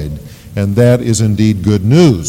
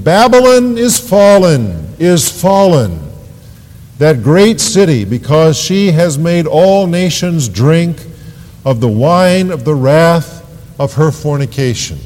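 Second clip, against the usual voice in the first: scripture proclamation; 5 semitones higher, 3 dB louder than usual. Acted. Preacher reads the angel's cry over Babylon, solemn proclamation.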